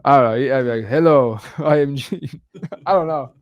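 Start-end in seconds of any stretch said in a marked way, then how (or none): 0.9-2.4 lightly put-on hard Russian accent; mock Russian accent for a self-introduction. Host showing how he would fake it, mock Russian accent. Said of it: "like some hard Russian accent".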